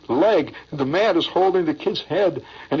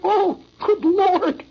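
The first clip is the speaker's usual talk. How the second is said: put-on awed kids; 14 semitones higher, 3 dB louder, much slower than usual. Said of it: "all of us kids are sitting there".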